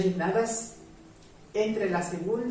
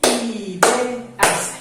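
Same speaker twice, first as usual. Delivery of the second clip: Ideas split into beats, louder; counting syllables; 2 semitones higher, 8 dB louder than usual. Shown as a demonstration. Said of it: "¿Cuántas sílabas tiene la palabra ideas?". (decir Ideas marcando cada sílaba, más fuerte)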